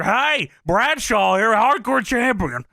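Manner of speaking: loud, drawn-out, high hail; hailing loudly. Acted. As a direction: boom out a big mock greeting. Acted interruption of a wrestler.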